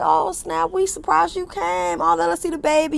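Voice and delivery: excited crowd greeting, lightly voiced; mock excited greeting. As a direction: coo like everyone just saw the baby. Voicing everybody greeting the baby.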